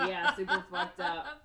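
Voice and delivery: laughing. Real, not acted. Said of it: "laugh heard".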